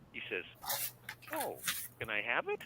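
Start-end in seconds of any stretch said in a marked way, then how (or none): 1.3-2.6 lightly voiced cheery alien, louder; cheerful alien. Alien asks narrator for the CD, lightly imitated cheer. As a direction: imitate an eager alien's request, mildly cheerful. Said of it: "that's how he talks".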